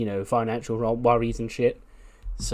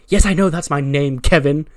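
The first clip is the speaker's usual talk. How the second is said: exasperated wrestler; 3 semitones higher, 9 dB louder than usual. Acted. Gable snaps at the interviewer.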